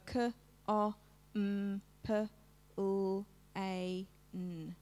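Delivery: "K, O, M, P, L, A, N" as separate phonemes, kuh, o, mmm, puh, lll, ay, nnn; sounded out. Shown as a demonstration. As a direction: segment "K, O, M, P, L, A, N" sound by sound, not letter names. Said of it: "say it as they write it".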